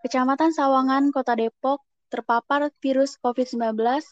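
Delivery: formal newscaster delivery; news read. Acted. Reads a news item.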